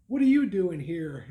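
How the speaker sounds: mock nasal whine of surprise; nasally voice. Woman surprised to see him at a New Jersey show, nasal voice. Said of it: "I heard this nasally voice".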